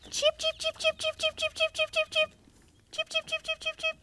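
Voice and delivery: high soft cheeping chick. Retold or imitated. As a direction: peep like a tiny chick. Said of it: "Little baby chicken goes".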